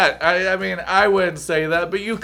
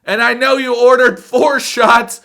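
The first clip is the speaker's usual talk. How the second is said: exasperated scold; 6 semitones higher, 7 dB louder than usual. Real, not acted. Scolding the TV dater.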